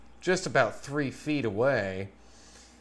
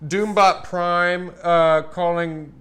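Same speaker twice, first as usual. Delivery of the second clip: robot announcer; 4 semitones higher, 8 dB louder, slower than usual. Pretend.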